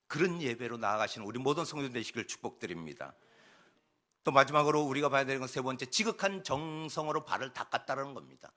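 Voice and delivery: usual speaking voice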